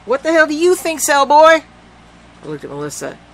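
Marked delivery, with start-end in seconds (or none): 0.1-1.6 high, loud mocking jab; sarcastic retort. A narrator's reading. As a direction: scoff at him.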